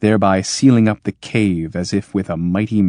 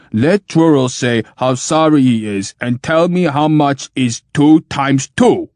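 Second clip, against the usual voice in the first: demanding machine; 6 semitones higher, 5 dB louder than usual. Acted. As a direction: lay down the order like a machine.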